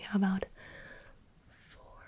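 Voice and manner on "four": whispering in ear. Shown as a demonstration. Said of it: "I'll whisper it in your ear".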